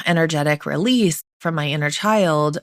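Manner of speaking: ordinary delivery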